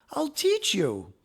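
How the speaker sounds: put-on a girl eagerly offering to teach; eager cry. A narrator's reading. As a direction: burst out with a happy offer. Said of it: "cried Angelina".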